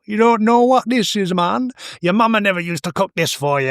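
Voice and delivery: high-pitched put-on voice of a teasing shopkeeper. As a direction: tease the customer in a high, put-on voice. Mocking shopkeeper [name]'s put-on voice.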